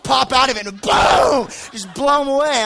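sudden loud shout on boom. Retold or imitated. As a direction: burst out loud as the trap springs. Ambushing ducks, boom.

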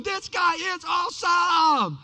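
high, excited shout of amazement, easing in volume; awestruck husband. Acted. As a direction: whoop like a thrilled spectator. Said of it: "husbands are slapping their wives going".

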